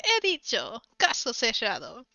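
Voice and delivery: loud, emphatic Spanish verdict; passionate TV judge. Retold or imitated. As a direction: declare the ruling with passion. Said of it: "She's so passionate about using the hammer".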